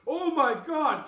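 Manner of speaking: high, very loud, drawn-out exclamation; shocked yell. Retold or imitated. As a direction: re-enact yelling in stunned shock. Retells shock at exploding bird.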